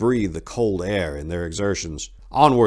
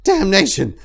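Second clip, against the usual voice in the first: sharp high loud oath; startled swearing; fearful curse; 12 semitones higher, 8 dB louder than usual. A narrator's reading. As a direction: spit the curse out in fright, higher and louder. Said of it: "Lucius cursed".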